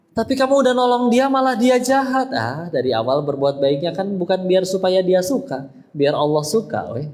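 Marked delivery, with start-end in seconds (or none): 0.1-2.3 high-pitched mock protest; insistent acted complaint in a high pitch; objector's voice. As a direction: play an aggrieved objector, pitched well up.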